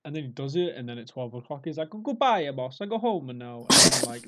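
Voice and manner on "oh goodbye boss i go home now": high, loud comic Italian worker; Italian accent. Acted. Lazy Italian worker putting off an order, mock Italian accent. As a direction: play a hammed-up Italian slacker. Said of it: "I say Italian".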